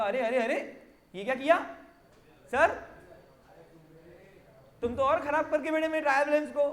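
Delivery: alarmed client objecting, rising questions; mock protest. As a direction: complain to the accountant in mock dismay. Acted client protesting spoiled balance. (घबराए client की नकली आपत्ति)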